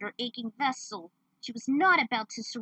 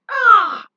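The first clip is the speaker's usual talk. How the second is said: sharp high yelp of pain; pained cry; 13 semitones higher, 19 dB louder than usual. Acted. Fighter hit in the head, loud pained yelp. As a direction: scream as the blow lands. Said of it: "He was hit directly".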